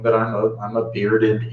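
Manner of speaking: ordinary talk, nothing marked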